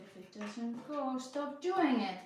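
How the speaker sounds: slow, emphatic, rising high and loud on "doing"; quoted self-advice. Retold or imitated. Puzzler quoting advice she keeps ignoring, slow and emphatic. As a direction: recite your own rule with mock insistence. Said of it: "telling myself".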